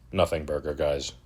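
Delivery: a soft, deflated "Nothing burger"; quiet dismissive. Real, not acted. Repeated dismissal.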